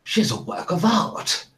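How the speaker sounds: awestruck lord, swooping up high; hushed gasp building to high, swooping delight; Lord Botherington Botherington Smythe. Acted. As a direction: marvel theatrically over a speaker. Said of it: "Lord Botherington Botherington Smythe go".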